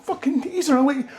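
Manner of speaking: very high, laughing squeal; swooning fangirl squeal. Retold or imitated. Women reading the book, squealing high and laughing. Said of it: "And women go".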